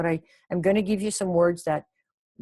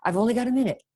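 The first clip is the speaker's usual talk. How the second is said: higher pitch, falling away on "minute"; demonstrated intonation; 5 semitones higher, faster than usual. Shown as a demonstration. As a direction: lift the whole line, then drop at "minute". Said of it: "That's how you say it".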